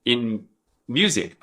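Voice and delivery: ordinary talk, nothing marked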